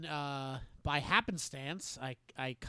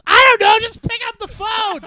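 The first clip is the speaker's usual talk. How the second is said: much higher, louder, impatient shout; 18 dB louder than usual. Retold or imitated. Mom telling daughter to pick up, shouting impatiently. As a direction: yell it back, fed up.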